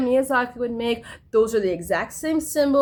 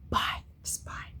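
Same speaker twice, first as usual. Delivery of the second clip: dismissive whisper; 12 dB quieter than usual. Retold or imitated. Brushing off her friends with a whispered bye. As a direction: whisper a tired bye, barely voiced.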